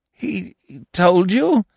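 slow, shaken stammer. A narrator's reading. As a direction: ask it as if the floor gave way. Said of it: "he stammered".

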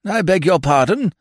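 jolted into asking; startled. A narrator's reading. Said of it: "with a start".

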